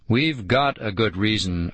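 ordinary delivery, nothing marked

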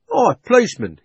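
loud, high hail; red-faced cabby. A narrator's reading. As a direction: call out to the policeman. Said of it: "he said".

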